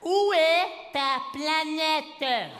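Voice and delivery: very drawn-out, high call; talking to an alien. Acted. Schoolkids questioning the strange new boy, drawn-out alien-greeting voice. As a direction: stretch each word out, high, as if hailing an alien. Acting kids addressing him as alien.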